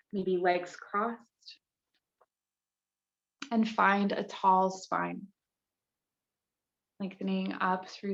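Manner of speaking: calm, measured guide with long pauses between phrases; unhurried yoga meditation voice; guided meditation. Real, not acted. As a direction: lead the circle gently into stillness, leaving space after each instruction.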